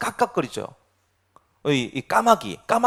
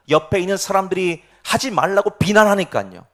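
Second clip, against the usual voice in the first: ordinary delivery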